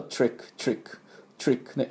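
トリック with t and r run together as one breathy sound and no vowel between; トリック said quietly, voiceless, in tight repeats; tr cluster drill. Shown as a demonstration. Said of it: "TですぐRに持っていかなきゃいけないんで". (「トリック」をささやき気味に練習)

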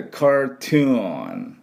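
ゆっくり高めに「カートゥーン」; 「カートゥーン」の各音節を長く伸ばす; 英語発音の手本. Shown as a demonstration. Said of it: "こういう音になります". (英語風に「カートゥーン」、最後のNまでゆっくり)

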